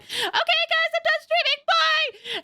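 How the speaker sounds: bright fake-energy stream sign-off; mock cheery sign-off. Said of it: "where I'm like".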